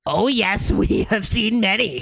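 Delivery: high, giggly gargoyle voice; giggling gargoyle. Acted. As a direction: chuckle through it like a smug stone gargoyle. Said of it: "says the other, giggling".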